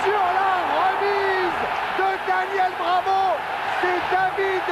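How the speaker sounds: shouted goal calls, stretched; excited sports commentary. Real, not acted. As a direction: build to the goal, then shout it. Live match commentary, goals scored.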